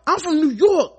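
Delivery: high, loud mock New York tough guy; posturing bravado, high and loud; tough New Yorker. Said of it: "He was like".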